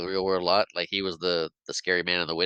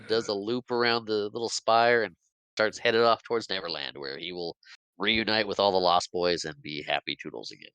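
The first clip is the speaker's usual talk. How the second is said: ordinary delivery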